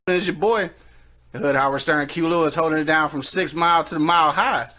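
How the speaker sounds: ordinary talk, nothing marked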